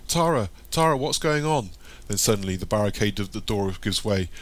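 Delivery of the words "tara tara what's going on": high, anxious calling; acted bewildered alarm; alarmed question. A narrator's reading. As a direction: call out in alarm, pitch raised. Dazed boy calls out in chaos.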